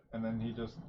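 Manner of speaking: usual speaking voice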